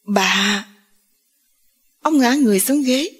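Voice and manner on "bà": understated stunned utterance; one word drawn out in mild shock; a touch of stunned recognition. A narrator's reading. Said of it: "ông bàng hoàng".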